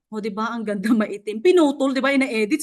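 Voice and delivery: ordinary talk, nothing marked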